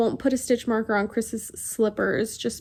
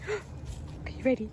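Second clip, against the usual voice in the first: talking to a dog; 10 dB quieter than usual. Real, not acted. Teasing the dog.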